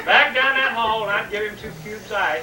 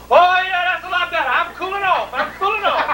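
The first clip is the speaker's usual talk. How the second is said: high, sighing relief; relieved dad; 9 semitones higher, 5 dB louder than usual. Retold or imitated. Dad relieved by the fan, high voice. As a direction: sigh with relief as the air comes.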